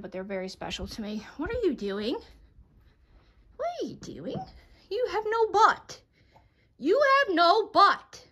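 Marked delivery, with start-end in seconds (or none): 1.4-2.2 slightly raised, teasing question to the dog; mild pet voice, just lifted; pet talk. Real, not acted. Talking to her dog. 3.6-4.4 very high, squeaky pet voice, softer; pet talk. Real, not acted. Talking to her dog. 4.9-8.3 pet talk. Real, not acted. Teasing her dog.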